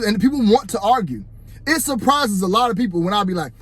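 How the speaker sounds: ordinary delivery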